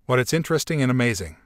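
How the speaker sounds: usual speaking voice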